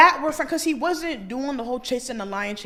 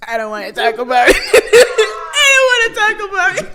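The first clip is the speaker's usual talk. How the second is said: loud, high laughing cry; half laughing, half crying out; laughing wail; 10 semitones higher, 14 dB louder than usual. Real, not acted. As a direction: wail it through helpless laughter. Laughing wail over spent money.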